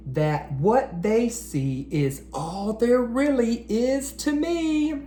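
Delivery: lively rhyming picture-book read-aloud; reading to children. Acted. Teacher reading a rhyming book to boys and girls, storytime read-aloud. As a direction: read each rhyme out to a room of young kids.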